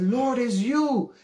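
high, slow tearful cry, acted; crying plea. Crying teenager calling on the Lord, pleading. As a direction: plead upward, stretching the words. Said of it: "I'm crying".

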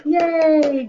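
big high cheer for the class; excited cheer. Real, not acted. Cheering kids after counting.

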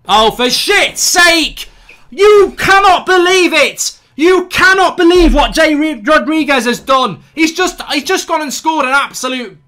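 furious outburst. Real, not acted. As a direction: bellow in disbelief at the goal. Goal scored while he watches.

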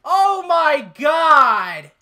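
loud, high, drawn-out wail; anguished shout. Real, not acted. Gamer just killed with a pistol, anguished shout. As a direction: stretch it out slowly in loud despair.

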